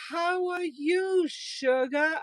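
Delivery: high, slow Southern sweet-talk; Southern accent. Retold or imitated. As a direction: stretch it out like a hammed-up Southern waitress. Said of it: "I can't do the accent the way they do it down here".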